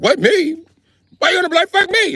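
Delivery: very high, loud shocked British squeal; squealing mock British outrage. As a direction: Brit recoiling in disbelief. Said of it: "The British guy was like".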